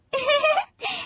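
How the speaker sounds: very high soft giggle; a tiny high giggle. Acted. Rinki giggles.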